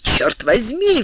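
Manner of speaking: high, drawn-out exclamation; startled, high-pitched oath; astonished cry. A narrator's reading. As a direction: cry out in slow amazement. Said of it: "вскричал Д'Артаньян".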